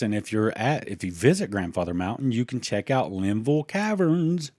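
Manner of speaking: ordinary delivery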